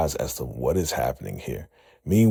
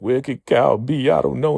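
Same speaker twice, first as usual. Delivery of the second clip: worried; 8 semitones higher, 5 dB louder than usual. Retold or imitated. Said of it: "Desiree's all worried".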